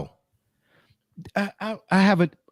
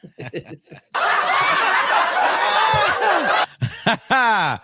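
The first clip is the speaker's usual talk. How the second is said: long laugh; 13 semitones higher than usual. Real, not acted. Host cracking up at being fourteenth of twelve. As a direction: let it roll into a long helpless cackle. Hosts crack up at ranking joke.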